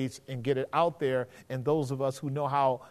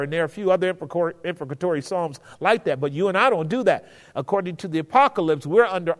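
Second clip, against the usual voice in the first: usual speaking voice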